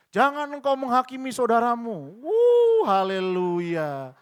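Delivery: loud, high mock-righteous proclamation; high belted preacher comeback; retort with scripture. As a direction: hammer the verse back, then whoop a drawn-out hallelujah. Hitting back with a verse.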